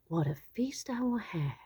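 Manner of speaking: gleeful whisper; whispered thought. Acted. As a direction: breathe it out like a secret treat.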